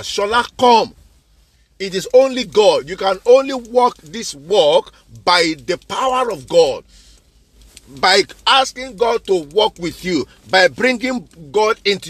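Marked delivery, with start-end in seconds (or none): none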